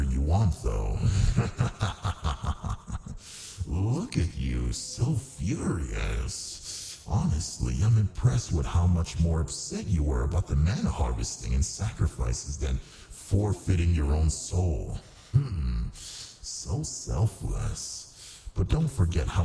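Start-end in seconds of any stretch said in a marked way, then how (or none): 1.0-3.2 long, amused villain laugh; villain's chuckle. Acted. Demon laughs at the furious ruler over human sacrifice, gloating chuckle. 3.7-7.0 slow, drawn-out taunt, sinking softer; mocking coo. Demon taunts the furious ruler after laughing, mock-admiring. 15.3-18.4 soft, very slow, drawn-out purr; mock admiration. Demon mocks the ruler who forfeited their soul, softly admiring.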